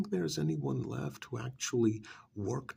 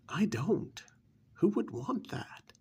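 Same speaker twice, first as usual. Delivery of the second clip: higher-pitched scoffing disbelief; incredulous dismissal; 7 semitones higher, 3 dB louder than usual. Acted. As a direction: scoff at the very idea. Scoffing at a life of struggle.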